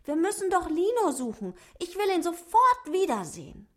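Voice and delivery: high, fast, pleading dwarf voice; high little dwarf begging, rushing his words. Acted. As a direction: beg quickly and urgently in a tiny voice. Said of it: "bettelte Otto".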